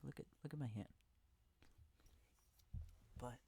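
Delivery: ordinary talk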